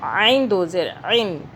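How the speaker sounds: عین squeezed from the throat, slowly drawn out; throaty ain. Shown as a demonstration. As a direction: stretch each عین from the throat. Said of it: "حلق سے عین کی آواز کو نکالنا ہے". (عین حلق سے، کھینچ کر)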